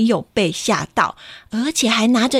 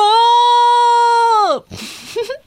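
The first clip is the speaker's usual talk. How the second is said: high, loud sung laugh; a big playful rising laugh; storyteller laughing out loud for the children; 12 semitones higher, 4 dB louder than usual. Acted.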